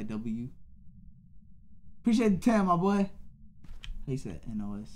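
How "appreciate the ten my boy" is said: higher, louder shout-out for a donation; big up the donor; hyped thanks. Real, not acted. Streamer thanks a viewer for the ten, loud and high.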